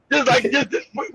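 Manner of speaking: normal talking voice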